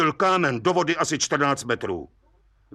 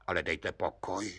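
much softer, lower, hushed amazement; astonished; 8 semitones lower, 12 dB quieter than usual. Acted. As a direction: say it half to yourself, stunned. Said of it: "užasl pan soudce".

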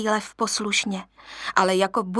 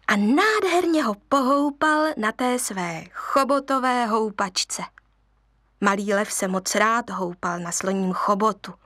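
usual speaking voice

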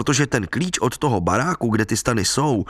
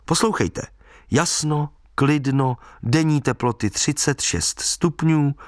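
measured newspaper read-out, item by item; evenly spaced forecast announcement; 3 semitones higher than usual. A narrator's reading. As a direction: read it out deliberately, pausing between items. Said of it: "otevřel noviny".

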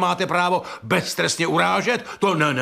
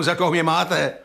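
ordinary talk

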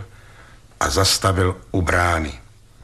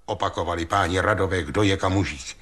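ordinary delivery

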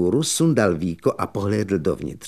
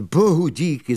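výš posazené Bohu díky s úlevou; úlevné zvolání; 6 semitones higher than usual. Acted. (otec štěněte zvolá úlevou)

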